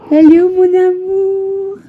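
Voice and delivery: very high, slow cooing. Real, not acted. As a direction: stretch it out sweetly to a pet. Greeting cat in baby voice.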